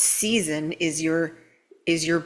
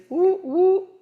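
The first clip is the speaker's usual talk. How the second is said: high, loud mock woo woo; cartoonish high woo; silly high woo; 12 semitones higher, 4 dB louder than usual. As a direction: toss off a goofy high-pitched woo. Mocking the analyst mind.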